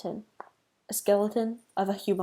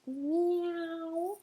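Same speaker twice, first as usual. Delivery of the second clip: high, eerie drawn-out meow; a ghost cat moaning to scare; scary ghost meow; 9 semitones higher, 4 dB quieter than usual. Acted. Ghost cat meowing at the mouse, high eerie meow. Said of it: "in a scary voice".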